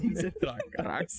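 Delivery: drawn out through laughter; amused, slow and laughing. Real, not acted. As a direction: crack up while repeating it. Friend cracking up at the word.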